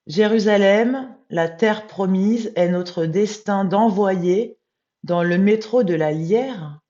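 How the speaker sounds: loud, slow proclamation; solemn text recitation. Real, not acted. Rereading the whole text to viewers, loud, slow recitation. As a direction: read it out slowly and forcefully, like a declaration. Rereads the French translation aloud.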